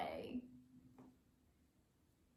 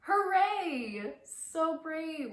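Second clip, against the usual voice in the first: cheering; 7 semitones higher, 6 dB louder, much slower than usual. Real, not acted. Cheering at birthday video start.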